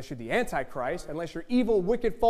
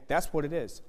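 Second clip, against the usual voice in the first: normal talking voice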